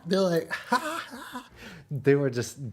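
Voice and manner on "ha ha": high mock "ha ha"; hammed-up high-pitched cackling; imitated laughter. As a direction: imitate a crowd cracking up. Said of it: "laughing their asses off".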